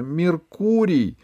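high, slow call of the name; high drawn-out Меркурий; shouted name. A narrator's reading. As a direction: call him again, running towards him. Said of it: "закричала она снова".

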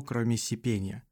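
ordinary delivery

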